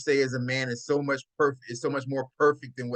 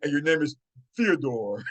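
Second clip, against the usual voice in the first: mock proper name. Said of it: "you talk like this".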